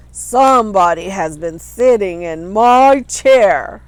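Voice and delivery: loud booming papa bear; great big bear voice. A narrator's reading. Papa bear finds his cushion crooked, loud booming voice. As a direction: boom it out like a big grumpy bear. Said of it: "in his great big voice".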